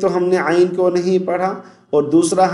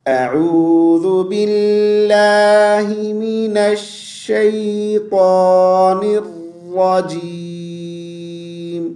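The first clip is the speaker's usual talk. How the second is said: Quranic recitation; 2 semitones higher, much slower than usual. Shown as a demonstration. Teacher opens the lesson for his viewers with chanted recitation. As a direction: recite it melodically, stretching each word.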